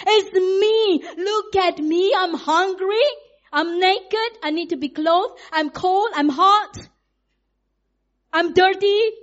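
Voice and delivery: put-on loud, high, insistent baby voice; demanding baby. Preacher voices a newborn demanding attention, loud and high. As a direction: whine every need like a demanding newborn. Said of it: "we come out screaming".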